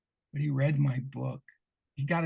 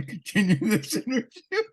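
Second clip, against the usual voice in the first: laughing through it; 7 semitones higher, 3 dB louder than usual. Real, not acted. Retelling doubts about a guest.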